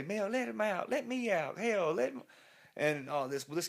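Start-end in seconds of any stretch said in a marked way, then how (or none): 0.0-2.2 high pleading voice; nervous plea. Retold or imitated. Nervous front-seat girl begging Mel, high pleading voice. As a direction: beg him to stop. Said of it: "she's getting nervous now".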